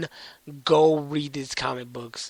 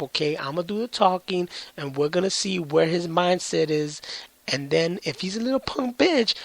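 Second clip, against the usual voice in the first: pumped-up big brother plotting a takeover, higher and brash; cocky brother; 6 semitones higher than usual. Retold or imitated. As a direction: hype up your little bro, swaggering. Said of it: "Goldar's brother's all like".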